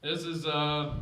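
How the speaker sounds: ordinary delivery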